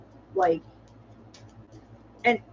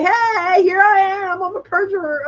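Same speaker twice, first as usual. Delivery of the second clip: higher, louder mock juror boasting; mock juror confession; 9 semitones higher, 7 dB louder than usual.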